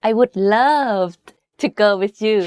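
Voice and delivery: "love" stretched out, pitch going up then falling; emphasis on LOVE. Shown as a demonstration. Teacher likening falling tone to English, emphatic stress on "love". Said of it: "when you are emphasizing something in English".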